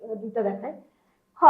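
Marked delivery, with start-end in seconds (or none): 1.4-1.4 "খ" as an aspirated k, the sound of pinyin k; demonstrating k sound. Shown as a demonstration. Teacher giving the sound of k, pronunciation demo of "খ". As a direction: say "খ" with a puff of air. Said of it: "কে এর sound হচ্ছে খ".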